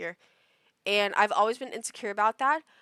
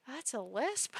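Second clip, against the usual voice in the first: soft, breathy disbelieving question; hushed disbelief; stunned question; 9 dB quieter, slower than usual. Retold or imitated. Realizing the lisp is not an accent, hushed disbelief. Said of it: "And I literally went".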